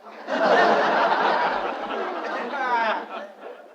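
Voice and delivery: long airy laugh; unvoiced rolling laugh; breathy laugh. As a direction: laugh it off in disbelief. Father laughs at neighbour's nerve.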